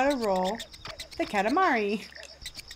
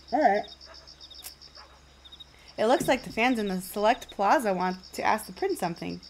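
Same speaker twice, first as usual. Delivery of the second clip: ordinary talk, nothing marked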